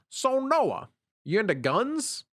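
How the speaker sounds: high, slow Kermit-ish coworker voice; a light hint of Kermit the Frog. Coworker asking Noah about guns, lightly Kermit-like. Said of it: "he sounds like Kermit the Frog".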